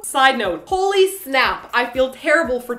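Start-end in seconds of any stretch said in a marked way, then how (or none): none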